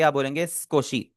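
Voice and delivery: normal talking voice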